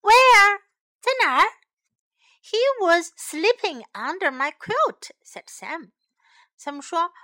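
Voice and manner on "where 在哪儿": high, loud question; excited question. A narrator's reading. Stella asks where Fred is, excited shout. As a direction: fire it back, pitched right up.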